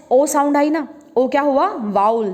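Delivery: ordinary talk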